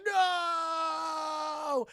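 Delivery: high, loud despairing cry; anguished cry. Retold or imitated. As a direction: scream as it's dragged under. Creature dragged into abyss.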